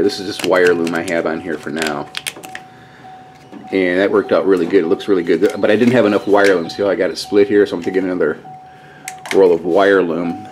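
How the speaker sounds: normal talking voice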